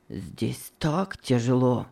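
slow, soft, weary plea; pleading sadly. Acted. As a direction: ask quietly, worn down. Slaves plead to be bought.

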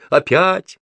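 "Опять" pitched very high, climbing; exclaimed answer. A narrator's reading. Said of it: "ответила Груша". (высокое восклицание «Опять»)

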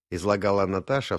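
plain reading voice

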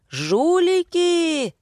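long, high-pitched yell; drawn-out outraged cry. A narrator's reading. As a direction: stretch the accusation into a high holler. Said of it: "заорал мужчина".

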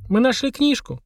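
put-on mildly miserable, high girlish voice; high and unhappy, like a confession. A narrator's reading. Polina confessing to Mashka about the book, miserable. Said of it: "несчастным голосом сказала Полина".

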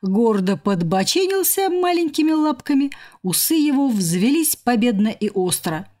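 plain reading voice

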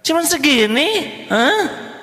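high scornful wife voice, scoffing; wife sneering at a husband's small gift, high and scornful; mocking wife.

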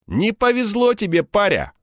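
high-pitched mocking thug; smirking. A narrator's reading. Second thug taunting captured Egor, smirking. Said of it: "ухмыльнулся другой".

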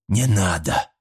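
ordinary delivery, nothing marked

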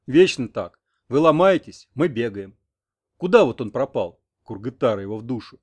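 loud, grumbling complaint. A narrator's reading. Old Kurov grumbles about women, worried for his grandson. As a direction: grumble it out with force. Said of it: "проворчал Куров".

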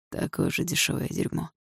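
soft, understated sneer; smirking contempt. A narrator's reading. Дима insulting his rival, quietly contemptuous. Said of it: "ухмыльнулся Дима".

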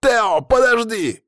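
high, drawn-out shout to stop someone; shouted call. A narrator's reading. Narrator calling after the dragon-cat leaping away, urgent shout. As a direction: call out loud, high and stretched. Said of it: "воскликнул я".

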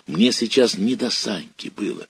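plain reading voice

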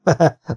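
short easy laugh; light laugh. A narrator's reading. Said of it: "Könnyedén felnevettem".

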